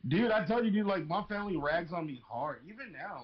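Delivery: ordinary talk, nothing marked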